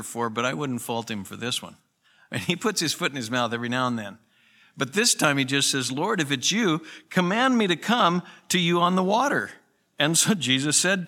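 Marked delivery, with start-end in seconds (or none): 5.9-9.5 eager plea. Retold or imitated.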